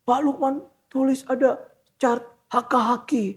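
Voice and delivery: high-pitched impression of an old man; elderly man's accent. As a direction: put on an old trader's voice. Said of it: "dengan logat-logat udah tua gitu". (menirukan suara orang tua)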